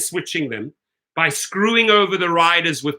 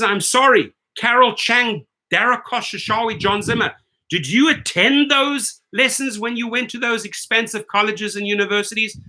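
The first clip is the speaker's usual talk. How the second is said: ordinary talk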